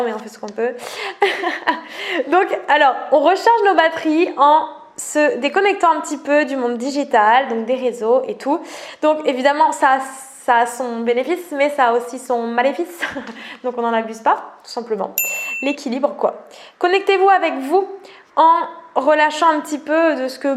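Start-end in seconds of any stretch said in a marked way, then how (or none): none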